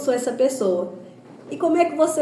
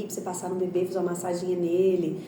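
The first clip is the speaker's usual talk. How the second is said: ordinary talk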